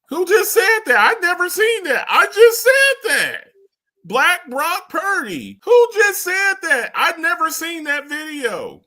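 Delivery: high, loud indignant outburst; mock outrage. Mock outrage at a stolen line.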